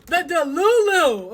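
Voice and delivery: high, loud defiant declaration; defiant vow. Retold or imitated. Vowing to out the affair to the wife, defiant.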